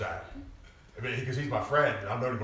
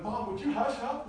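teasing hush; 14 semitones higher than usual. Retold or imitated. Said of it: "I said".

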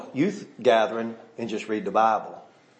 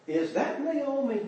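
high, soft, slow wondering question; disbelieving question; 10 semitones higher, 6 dB quieter, slower than usual. Acted. Townsfolk looking at the returned Naomi, hushed disbelieving question. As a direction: ask it softly, pitched way up, unsure it's her. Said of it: "look at her and go".

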